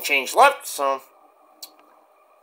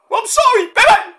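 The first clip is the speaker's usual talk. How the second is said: very high, louder whiny goodbye; sulking Cactus; 4 dB louder than usual. Acted. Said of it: "Mick Foley impersonation".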